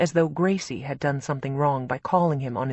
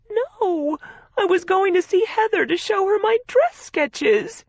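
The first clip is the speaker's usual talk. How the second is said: tearful explaining; 6 dB louder than usual. A narrator's reading.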